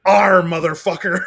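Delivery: loud, high pirate growl, laughing; imitated child pirate. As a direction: bellow it as a little kid playing pirate. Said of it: "What do pirates say?".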